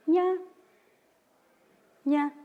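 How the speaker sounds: "ña" muy alto, luego baja; tono de "dónde". Shown as a demonstration. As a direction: sube mucho el tono de "ña". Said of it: "Estoy diciendo, ¿dónde?". ("ña" con tono alto de dónde)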